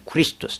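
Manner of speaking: クリストス said kurisutosu with stress on リ; ドイツ語風にクリストス; German pronunciation. Shown as a demonstration. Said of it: "ドイツ語でクリストスです".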